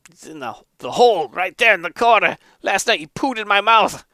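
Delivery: high-pitched exasperated complaint; disgusted monk. Acted. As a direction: report it in high, indignant disgust. Monk complains about mouse.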